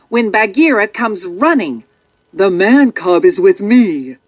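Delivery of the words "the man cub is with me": lightly voiced panther, a touch lower; calm panther speaking firmly; Bagheera's voice. A narrator's reading. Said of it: "the panther tells the colonel".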